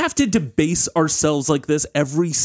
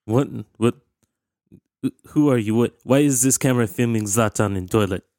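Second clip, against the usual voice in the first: put-on Swedish accent; 3 semitones lower than usual. Zlatan in third person.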